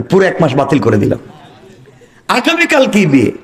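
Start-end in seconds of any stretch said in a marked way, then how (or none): 2.3-3.3 high, arching cry of a question; astonished outcry. Acted. Preacher repeats the wedding question to the crowd, astonished. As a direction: exclaim it in disbelief, pitch soaring.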